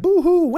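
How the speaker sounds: high, loud hammed-up boo-hoo wail; mock baby crying. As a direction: wail like a fussy infant, overdone. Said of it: "you're like".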